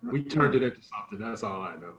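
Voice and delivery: ordinary delivery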